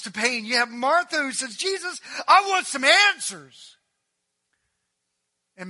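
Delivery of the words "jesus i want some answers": very high, loud acted outburst; angry demand. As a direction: demand answers as the fed-up sister. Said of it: "they were angry. They wanted answers".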